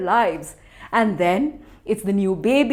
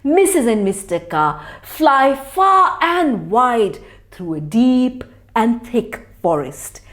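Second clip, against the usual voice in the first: ordinary delivery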